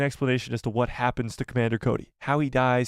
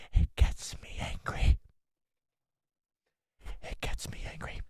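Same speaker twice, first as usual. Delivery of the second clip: seething whisper, trailing off softer; angry whisper; 7 dB quieter than usual. Acted. Streamer chiding a member over a comment, angry whisper.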